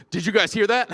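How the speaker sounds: loud, high startled question; alarmed. Retold or imitated. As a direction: jump up startled by the boom. Australian guy alarmed by gunshot.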